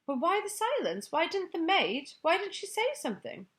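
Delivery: put-on high, eager child voice; a small girl wondering, pitched high; anxious young girl. Sophie's anxious inner voice.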